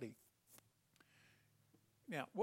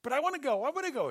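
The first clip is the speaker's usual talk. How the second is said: put-on high, loud, childish whine; eager pleading messenger; 10 semitones higher, 11 dB louder, much faster than usual. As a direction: beg like an impatient kid. Eager messenger begging again.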